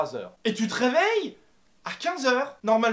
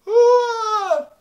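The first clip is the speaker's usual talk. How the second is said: a loud, much higher hammed-up yawn; over-the-top mock yawn; badly faked yawn; 12 semitones higher, 11 dB louder than usual. Shown as a demonstration. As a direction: fake a yawn, badly on purpose. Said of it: "c'est une simulation de bâillement, c'est très mauvais".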